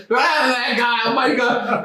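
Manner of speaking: high, slowed mock cry of alarm; imitating a startled casino crowd; shocked onlooker spotting a bloody man; alarmed bystander. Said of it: "people screaming".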